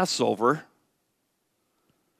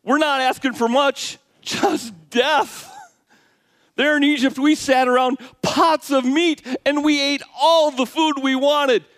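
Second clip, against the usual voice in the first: whiny complainers; 10 semitones higher than usual. Retold or imitated. Pastor mocks complaining Israelites with a high whine. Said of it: "The people are complaining".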